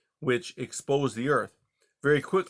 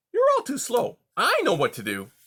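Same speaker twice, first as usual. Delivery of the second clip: higher, cocky fox voice; impatient young fox; 12 semitones higher, 4 dB louder than usual. Acted. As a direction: brush them off, impatient.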